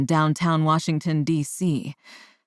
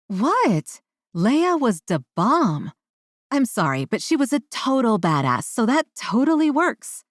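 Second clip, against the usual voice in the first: excited; 7 semitones higher than usual. A narrator's reading. Defending Star Wars excitedly.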